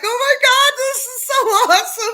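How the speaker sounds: high-pitched, loud, gushing squeal with a laugh; giddy shriek breaking into a laugh; squealing excitement. Retold or imitated. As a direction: relive the thrill of hearing the intro, squealing. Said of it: "I'm like".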